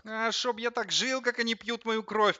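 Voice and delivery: high nasal grumble; nasal whine. A narrator's reading. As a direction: whine it through the nose, much put-upon. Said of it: "прогундел телевизор". (гнусаво, высоко причитая)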